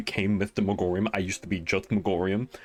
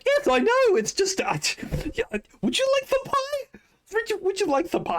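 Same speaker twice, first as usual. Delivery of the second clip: very high, squeaky flustered offer; flustered showman. Acted. Megorium flustered, offering pie.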